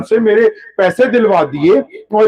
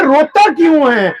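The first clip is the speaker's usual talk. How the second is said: higher, slower, caring ask; gentle insistent question; 8 semitones higher, slower than usual. Retold or imitated. Prophet presses the weeping slave, higher and slower. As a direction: draw out روتا کیوں ہے with روتا high, falling at the end.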